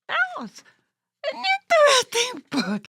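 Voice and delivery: squeaky high puppet exclaiming. Acted. Puppet Corona reacting to the book, high and bright. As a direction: pitch it way up like a little puppet.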